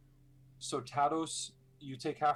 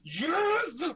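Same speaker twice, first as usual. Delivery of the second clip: a much higher, excited shout of yes; excited cheer; 6 dB louder than usual. Real, not acted. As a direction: cheer the player on. GM cheers player's channel divinity.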